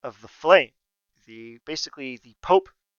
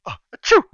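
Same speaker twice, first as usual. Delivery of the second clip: put-on high, loud girlish sneeze; a dainty little girl's achoo; cute little sneeze; 13 semitones higher, 15 dB louder, faster than usual. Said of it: "gives a cute little girl sneeze".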